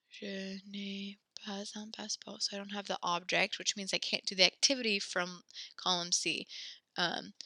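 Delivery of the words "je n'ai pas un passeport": whispered to herself. Shown as a demonstration. Teacher models the sentence for class, whispered. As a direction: whisper "Je n'ai pas un passeport" under the breath. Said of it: "so I'm gonna say".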